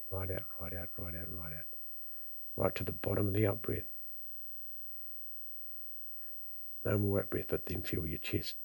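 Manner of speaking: soft, calm breathing instruction; guided breathing. Acted. Guides breathing exercise, soft and slow.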